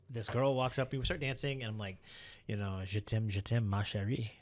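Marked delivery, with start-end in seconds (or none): 2.9-4.3 mock French. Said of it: "You know, it's like, "I love you".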